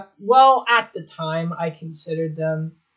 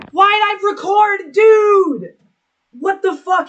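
much higher, louder cringing disbelief; 13 semitones higher, 7 dB louder, slower than usual. Real, not acted. Watching an old clip of a sleeping friend, high disbelieving yelp. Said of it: "That's so fucking creepy".

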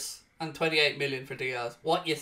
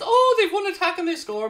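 mocking; 13 semitones higher, 5 dB louder than usual.

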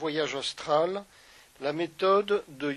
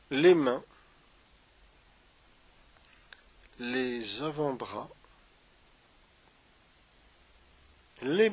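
unhurried meditation guide with long pauses; guided meditation; 2 semitones lower, 3 dB quieter than usual. Acted. Yoga teacher guiding listeners through a body scan, guided meditation.

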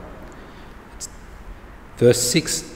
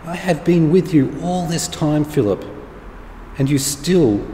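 Jesus's reproach; 5 semitones higher than usual. Acted. Voices Jesus rebuking Philip.

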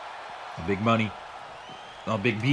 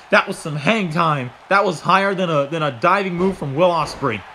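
normal talking voice

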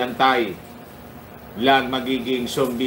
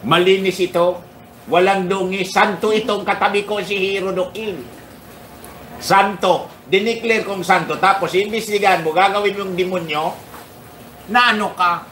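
mock retort to the president's friends, raised voice; mock defiant retort; 6 semitones higher than usual. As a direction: sarcastically insist he is a saint. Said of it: "Sabi ko".

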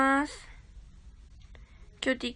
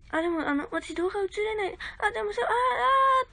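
flustered refusal; 8 semitones higher than usual. Retold or imitated. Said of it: "っていうので".

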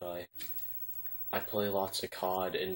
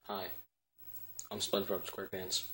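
ordinary delivery

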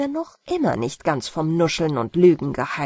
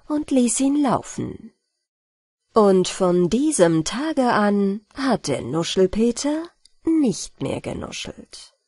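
usual speaking voice

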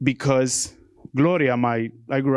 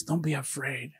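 quiet reassurance; 9 dB quieter than usual. Retold or imitated. Father reassuring his son, retold.

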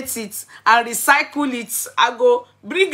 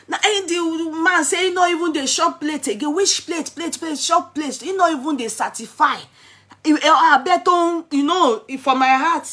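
excited retelling; 8 semitones higher, 3 dB louder than usual. Real, not acted.

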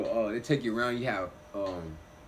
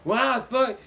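mom's outrage; 12 semitones higher, 8 dB louder than usual. Retold or imitated. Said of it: "she's like".

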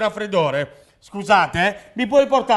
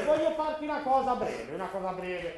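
sottovoce; 10 dB quieter than usual. Acted. Mago sussurra il prossimo trucco.

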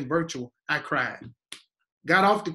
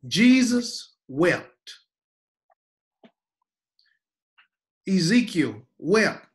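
preaching cadence; 2 semitones higher, 5 dB louder, much slower than usual. Real, not acted. Preacher building on lament.

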